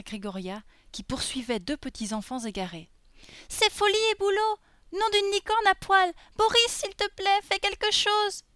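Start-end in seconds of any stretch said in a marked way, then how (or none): 3.5-8.4 put-on very high, loud child's cry for help; panicked little girl. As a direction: shriek a plea as a frightened little heroine. Voices Lolisa pleading with Boris.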